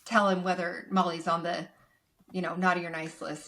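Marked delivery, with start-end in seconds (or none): none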